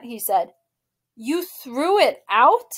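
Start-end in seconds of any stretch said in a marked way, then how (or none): 1.2-2.7 slow, higher, louder angry question; slow hurt outrage; mad disbelief. A narrator's reading. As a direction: demand it, stunned and mad. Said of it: "saw how mad I was".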